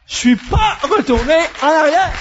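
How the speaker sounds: high, loud preacher's cry; triumphant proclamation. Real, not acted. Preacher telling the congregation he pressed on, triumphant. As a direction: proclaim it at full force.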